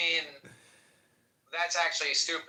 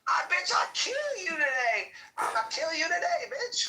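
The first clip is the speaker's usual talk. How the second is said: much higher mocking voice of an enraged worker. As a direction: ham up the furious threat. Friend replays worker's outburst.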